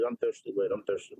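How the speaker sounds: dropped low. Pretend.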